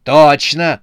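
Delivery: forceful one-word yell; shouted. A narrator's reading. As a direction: shout in eager agreement. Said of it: "закричал председатель".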